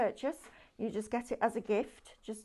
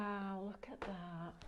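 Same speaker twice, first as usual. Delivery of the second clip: soft, slow, awed murmur; hushed wonder; 9 dB quieter, slower than usual. Real, not acted. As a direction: breathe it out in quiet awe. Friend admires the finished effect.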